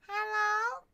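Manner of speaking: high, loud meow-like rising hello; cat meow voice. Retold or imitated. Imitating her cat Marie Antoinette calling out hello. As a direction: mew the word like a cat calling. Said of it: "That's what her meow sounds like".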